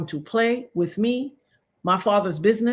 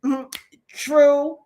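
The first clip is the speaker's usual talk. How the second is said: lightly voiced Gambian woman, a touch higher; a hint of a Gambian chick's okay boo; 3 semitones higher, much slower than usual. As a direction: say it like a girl from Gambia, breezy. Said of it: "when a chick in Gambia goes".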